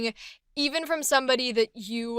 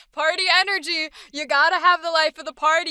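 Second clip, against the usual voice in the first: ordinary delivery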